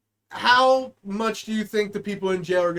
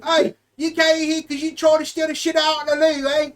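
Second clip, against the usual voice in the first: put-on loud, higher London-style accent; English accent; 5 semitones higher, 4 dB louder than usual. Doing a bad English accent for his friend's toilet joke. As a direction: bark it like a cockney inmate. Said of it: "do it with an English accent".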